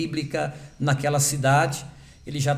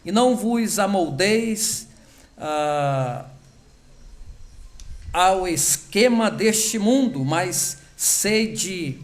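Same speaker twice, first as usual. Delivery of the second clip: scripture reading; 3 semitones higher than usual. Real, not acted. Pastor reading Romans twelve to the congregation, solemn scripture reading. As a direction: read the verses slowly as holy words.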